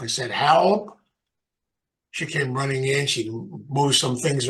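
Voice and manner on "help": a short, louder call for help; retold yell. Man calling his wife for help, mild retold yell. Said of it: "I yelled for my wife".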